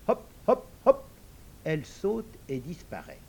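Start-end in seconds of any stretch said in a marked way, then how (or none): 0.1-1.0 high, louder bouncing hops; bouncy hop call. Acted. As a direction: sing out each hop as she leaps. Frog leaps away.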